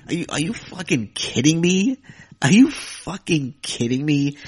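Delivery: loud, incredulous, clearly fed up; exasperated, can't believe what he's reading; outraged disbelief. Real, not acted. Outraged at troll comment.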